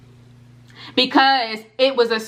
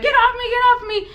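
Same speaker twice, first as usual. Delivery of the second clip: imitated scream; 14 semitones higher than usual. Telling viewers how they fought the spirit, mock scream. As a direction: reenact screaming at an attacker, pitch way up. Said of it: "And I'm screaming".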